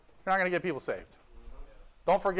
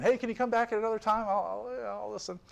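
higher, trailing-off put-off voice; brush-off listener; 5 semitones higher, 3 dB quieter than usual. Retold or imitated. Preacher mimicking people who never return, noncommittal. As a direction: imitate someone politely dodging, fading softer.

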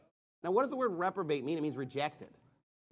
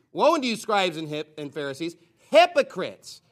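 shouted woe; 8 dB louder than usual. Acted. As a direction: hurl the word at them. Preacher reads Christ's rebuke.

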